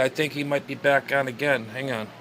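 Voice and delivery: normal talking voice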